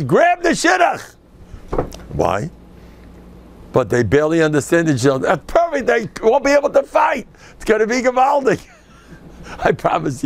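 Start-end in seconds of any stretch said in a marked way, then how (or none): 0.0-1.1 high slow shout; emphatic advice. Retold or imitated. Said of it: "I says". 5.3-8.7 excited pitch. Retold or imitated. Joking pitch of his advice.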